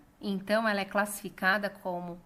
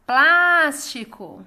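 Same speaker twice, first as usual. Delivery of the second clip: "Plástico" called loudly, PLÁS high and drawn out; calling out the word; 3 semitones higher, 10 dB louder, much slower than usual. Shown as a demonstration. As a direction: call out "Plástico", stretching a loud, high PLÁS. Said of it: "é a sílaba que foi alongada".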